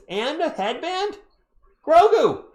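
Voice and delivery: high, incredulous rise into a loud call; marvel, then holler the name high and loud; amazed calling out. Real, not acted. Streamer calling Grogu about a player's headband, amazed.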